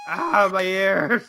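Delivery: high, drawn-out cry of pain; hammed-up agony, pitched high; pained wail. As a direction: wail slowly as if your ears hurt. Skit, noise hurts his ears.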